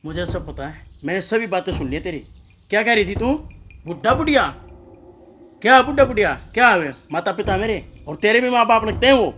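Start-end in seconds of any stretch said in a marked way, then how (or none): 2.7-9.3 louder, sharp accusing questions at his wife; angry confrontation. Acted. Husband scolding his wife for mocking his parents, angry. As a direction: confront her with what you overheard.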